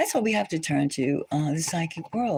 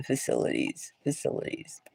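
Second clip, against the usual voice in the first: whispered, dropping to nothing; ominous whisper; 4 semitones lower, 6 dB quieter than usual. Real, not acted. Hinting at hidden undersea bases in a whisper. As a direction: breathe the word like a secret.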